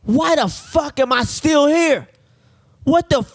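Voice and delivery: mock yell, stretched out in indignation; cursing patient. Hospital patient cursing from the next room, indignant yell. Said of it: "he's cursing like a".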